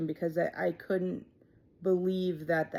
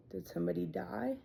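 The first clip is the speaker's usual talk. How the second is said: hushed dread; 7 dB quieter than usual. Retold or imitated. Dreading question to Eddie.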